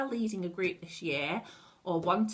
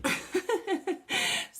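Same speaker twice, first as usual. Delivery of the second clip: light laugh; 10 semitones higher than usual. Real, not acted. Laughing at her own situation.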